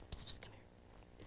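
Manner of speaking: secretive whisper. Retold or imitated. Leaning in to the girl, whispering. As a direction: whisper to call her close. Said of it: "play really serious".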